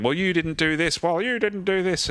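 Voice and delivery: high, whiny mock blame; mock bickering. Imitating crew in a meeting blaming each other, high and whiny. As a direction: squeal it like a petty colleague passing the buck. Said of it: "people going".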